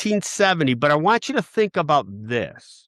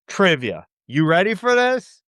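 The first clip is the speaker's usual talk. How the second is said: ordinary talk, nothing marked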